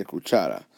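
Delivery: "cuchara" with natural stress on cha; stress on middle syllable. Shown as a demonstration. Teacher showing how we say it, "cuchara" accented on cha. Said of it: "The voice naturally goes right there".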